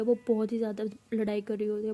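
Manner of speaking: plain reading voice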